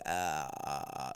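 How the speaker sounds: very low creaky grunt; deep vocal fry grunt. Shown as a demonstration. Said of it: "you would do that noise".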